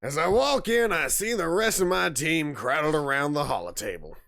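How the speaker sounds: Southern-accented storytelling. Shown as a demonstration. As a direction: narrate it in a put-on Southern accent. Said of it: "I can do that voice".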